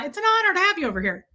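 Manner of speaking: ordinary talk, nothing marked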